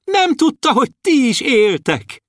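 tiny dwarf hollering happily; shouting dwarf. A narrator's reading. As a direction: call gleefully to the stars. Dwarf keeps calling.

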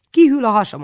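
high, loud whiny complaint; cheeky protest. A narrator's reading. Boy complains to aunt about the long greeting, high and loud. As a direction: whine it to get out of it.

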